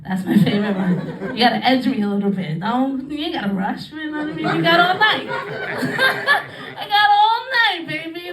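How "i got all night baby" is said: very slow, high, drawn-out seductive drawl with a laugh; slow sultry tease. Acted. Comic playing a lover's reply, slow and seductive. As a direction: stretch every word like a lazy tease.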